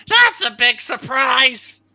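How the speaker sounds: parrot voice. Pretend. Parrot impression after Aladdin mention.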